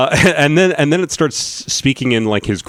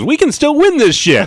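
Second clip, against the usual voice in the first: excited shout; 13 semitones higher than usual. Retold or imitated. Quoting Eddie Murphy's excited line.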